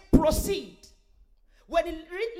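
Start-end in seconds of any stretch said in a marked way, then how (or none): 0.1-0.8 loud proclamation of the angel; angel's command. Retold or imitated. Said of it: "he will say".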